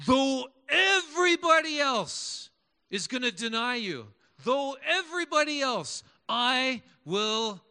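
high, loud, earnest vow; Peter's bold promise. Acted. Peter vowing loyalty to Jesus, high and loud. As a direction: swear loyalty to Jesus with fervour. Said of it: "Peter was like".

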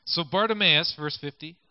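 ordinary talk, nothing marked

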